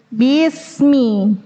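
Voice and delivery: BISMI with a short "bi" before the s. Shown as a demonstration. Teacher correcting lengthening, short bi. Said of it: "nggak ada huruf ya-nya di situ".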